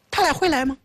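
lightly acted puzzlement; doubtful question. A narrator's reading. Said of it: "平王疑惑地问道".

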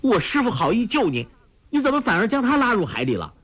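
put-on girl berating the villain; angry rebuke. A narrator's reading. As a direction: scold him hotly. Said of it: "黄蓉怒责".

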